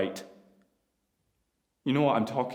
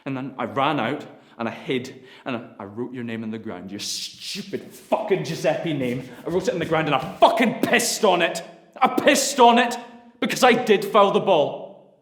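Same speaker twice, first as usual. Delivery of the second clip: higher and louder, raging and bitter; furious shout; 12 semitones higher, 13 dB louder than usual. Acted. Son raging at his father, shouting. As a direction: yell it, near breaking.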